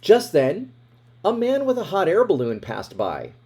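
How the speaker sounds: usual speaking voice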